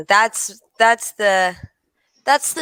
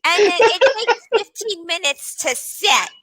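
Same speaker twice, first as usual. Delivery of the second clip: gossipy neighbor; 8 semitones higher than usual. Retold or imitated.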